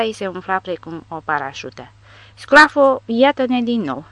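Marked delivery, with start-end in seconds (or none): 2.3-4.0 loud happy yell, drawn out; jolly soldier shouting; cheerful bellow. A narrator's reading. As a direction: bellow it gleefully at her. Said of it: "zbieră vesel un caporal român".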